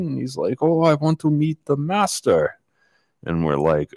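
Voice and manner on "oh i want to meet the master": imitating a Russian accent, much higher pitched; mock Eastern Bloc accent, pitched way up; thick Russian accent. Stranger at the lodge asking questions, high mock Russian accent. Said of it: "very thick Russian accent".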